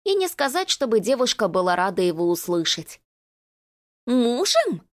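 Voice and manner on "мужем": put-on affected, ringing girlish voice; ringing mincing voice. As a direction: simper it, ringing and coy. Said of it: "Звонкий и жеманный голосок".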